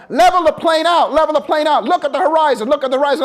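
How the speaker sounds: loud, high repeated orders; shouted commands. Retold or imitated. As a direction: hammer the orders at a panicking student. Instructor yelling commands.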